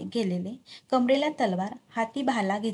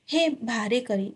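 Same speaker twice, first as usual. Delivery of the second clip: plain reading voice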